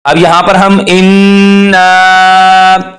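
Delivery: इन्ना drawn out slowly; stretched madd. Shown as a demonstration. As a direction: stretch इन्ना three alifs. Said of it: "तीन अलिफ के बराबर खींचेंगे". (खींचकर इन्ना)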